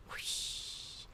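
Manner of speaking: soft breathy whoosh of a wave; hushed wave sound effect; whispered wave noise. Shown as a demonstration. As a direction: blow like ocean waves. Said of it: "I can make more waves".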